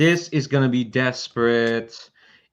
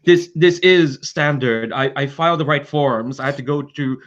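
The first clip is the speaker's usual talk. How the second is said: higher, halting protest; piping in; 4 semitones higher, 3 dB louder than usual. Acted. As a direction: stammer out a flustered official's defence. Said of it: "he pipes in and says".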